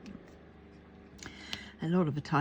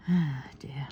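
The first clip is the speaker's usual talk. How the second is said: weary sigh; 2 semitones lower than usual. Real, not acted. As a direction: let out a tired sigh. Sighing to herself while working.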